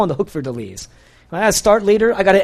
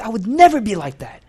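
raised, scornful disbelief; mock outrage at a spouse, pitched up; indignant self-righteous quote; 6 semitones higher than usual. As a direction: sneer at someone who doesn't live like you. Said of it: "And we say".

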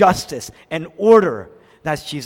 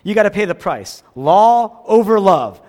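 stern demand; 4 semitones higher, 9 dB louder than usual. Retold or imitated. Preacher voicing our harsh rule, loud and stern. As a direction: hammer the condition home. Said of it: "That's what we say".

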